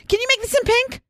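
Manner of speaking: high squeaky put-on voice asking sweetly; girly pleading voice. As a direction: squeak it out like an eager fan. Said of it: "I went".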